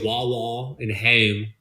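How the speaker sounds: slow Philly-accented WAH WAH and HOME. Shown as a demonstration. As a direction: demonstrate Philadelphia vowels, slowly, HOME fronted. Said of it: "That's Philly accent".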